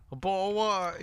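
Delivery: high, soft British put-on; mock British accent. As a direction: slip into a fake British accent. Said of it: "a British accent".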